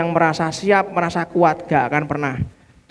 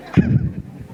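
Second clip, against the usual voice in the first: a high breathy laugh. Real, not acted. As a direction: laugh out at the memory. Laughing at the story.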